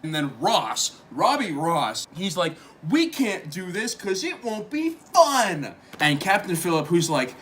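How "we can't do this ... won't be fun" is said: loud, raised-pitch mock objection; hammed-up loud complaint; mock protest. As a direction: protest loudly, playing the objector. Acted objection in a retold rehearsal.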